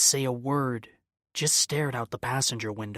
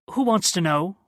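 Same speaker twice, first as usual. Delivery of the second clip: haughty, raised and louder, looking down; snooty professor; 7 semitones higher, 6 dB louder than usual. Acted. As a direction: sniff at them like a condescending don. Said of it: "sounding like a snooty college professor".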